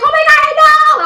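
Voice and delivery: loud, very high squeal; excited screaming. Retold or imitated. Girl screaming back to her best friend, excited. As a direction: imitate shrieking along with a friend. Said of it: "I was, like, just screaming".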